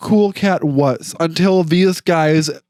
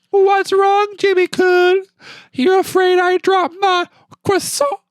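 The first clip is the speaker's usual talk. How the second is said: very high squeaky character voice, pitched higher still; high-pitched mock character voice; high squeaky impression; 14 semitones higher than usual. Shown as a demonstration. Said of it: "that's what I was trying to do".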